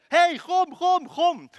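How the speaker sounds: loud, high, excited urging; eager beckoning. Acted. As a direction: cheer someone on to come over. Crowd's later reaction, beckoning him.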